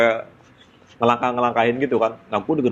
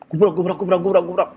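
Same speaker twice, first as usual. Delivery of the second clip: imitated footsteps; 5 semitones higher than usual.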